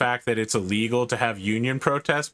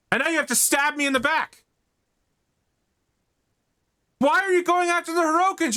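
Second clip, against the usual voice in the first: furious father; faster than usual. Acted. Game master as the father, furious, berating his son. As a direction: rant at the son, voice climbing high. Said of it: "He's so furious".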